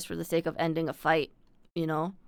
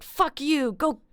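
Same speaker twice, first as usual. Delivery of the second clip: high, louder mock snap at a classmate; imitating her teenage self snapping, slowed at the end; 4 semitones higher, 3 dB louder, slower than usual. Teen self snapping at a classmate in high school, mock angry. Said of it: "I would snap".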